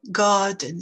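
"Garden" as long A, no R sound; silent R. Shown as a demonstration. Teacher drilling learners on silent R. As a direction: drop the R in "Garden", lengthen the A. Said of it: "c'est juste une voyelle longue".

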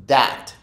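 "That" starting on a hard D, "dat". Shown as a demonstration. Teacher giving wrong examples on purpose, "That" pronounced with D for TH. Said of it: "con una D".

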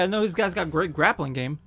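normal talking voice

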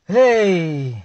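loud put-on call imitating Yau; hammed-up imitation of Yau calling out; mock shout in another voice; mimicking Yau. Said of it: "Mula kau dia".

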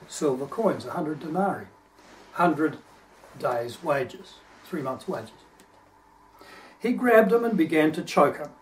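usual speaking voice

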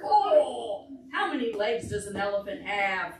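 usual speaking voice

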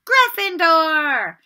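the hat announcing Ron's house; hat shouting. A narrator's reading. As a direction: boom the house name out. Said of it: "the hat had shouted".

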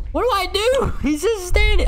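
very high nervous shriek; panicked squeal. Real, not acted. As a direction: squeal helplessly at the monster. Panicking at standing enemy.

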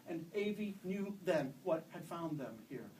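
usual speaking voice

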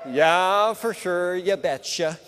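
lightly put-on Minnesota accent; teasing his Minnesota wife in a light Minnesota accent. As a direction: sing out Yeah, for sure, you betcha like a Minnesotan. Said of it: "my wife's from Minnesota".

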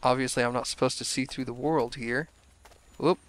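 ordinary delivery

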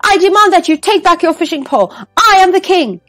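high, loud royal insistence; loud demand. A narrator's reading. King insists the stranger take back the pole, loud and high. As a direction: demand it with all your rank.

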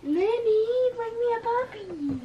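high, drawn-out singsong plea; pleading call. Real, not acted. Child begging for a puppy, high pleading call.

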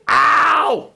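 high cry of pain. Real, not acted. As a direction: yelp as it squeezes. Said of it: "It's 'cause you scream".

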